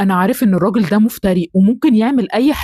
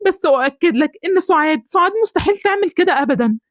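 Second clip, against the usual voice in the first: higher, urgent pleading for his wife; desperate pleading husband; 6 semitones higher than usual. Acted. Husband begs the neighbour to believe his wife, higher urgent plea. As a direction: beg him to believe she's innocent.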